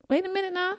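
high, soft wait a minute now; playful outrage; mock indignation. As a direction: stop them in a high indignant voice.

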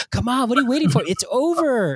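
put-on a restless five-year-old begging to go; whiny kid. Kid whines to leave theater.

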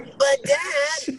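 put-on slow, high whiny kid; mock whine. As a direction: drag it out like a pouting child. Mock whining at dad.

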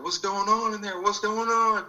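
high, anxious mom voice calling through a door; worried mom calling. Retold or imitated. Mom banging on the door, high worried call. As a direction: imitate mom calling out in alarm.

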